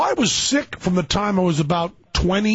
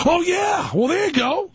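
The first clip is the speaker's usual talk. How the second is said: excited; 12 semitones higher than usual. Real, not acted. Host reacts to testicle kicking.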